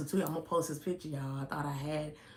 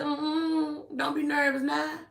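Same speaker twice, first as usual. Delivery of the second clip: high, whiny mock-crying tone; mock whiny plea; 10 semitones higher, 5 dB louder, much slower than usual. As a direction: tease him with a fake crybaby wail. Mock comforting nervous son.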